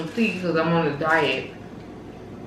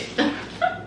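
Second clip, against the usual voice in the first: breathless laugh; 7 dB quieter than usual. Real, not acted. Laughs at the diet joke.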